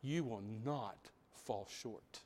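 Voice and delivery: slow, low and very soft; solemn quiet assurance. Real, not acted. Preacher assures listeners of the promise, hushed and slow.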